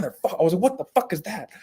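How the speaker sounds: high-pitched startled outburst, acted out; retold startled fright. Hunter retelling being spooked by a quail, startled. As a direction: blurt it in mock alarm, pitch leaping up. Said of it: "I got so scared".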